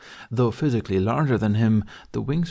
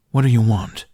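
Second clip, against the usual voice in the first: louder, impatient demand; 8 dB louder than usual. A narrator's reading. Toothless confronting the dragoness, loud impatient demand. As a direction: cut to the chase.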